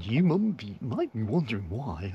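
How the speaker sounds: high, loud mock presenter voice; weird presenter. Vlogger mimicking the man filming next to him, weird presenter voice. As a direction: imitate an over-the-top presenter, voice swooping up. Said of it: "he has this sort of weird presenter tone".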